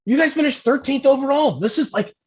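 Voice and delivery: very high-pitched exasperated rant; Jeff Wakeman, mock mad. Teammate ranting about the truck everyone sells, mock mad. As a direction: imitate a teammate squealing in comic outrage. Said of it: "He was so mad".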